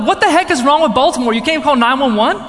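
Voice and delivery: high, loud, fast ranting; indignant, incredulous question fired off loudly; re-enacted exasperation; exasperated outburst. Exasperated outburst in retold story.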